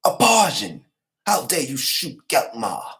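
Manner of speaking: loud, high accusation; outraged knight. Retold or imitated. Knight shouting at the Parsian archer who shot Geltmar. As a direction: hurl the accusation at him.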